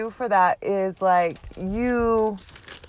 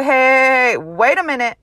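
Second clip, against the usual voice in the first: stopping oneself; 4 semitones higher, 3 dB louder than usual. Acted. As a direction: pull yourself up short. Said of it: "I have to stop myself and be like".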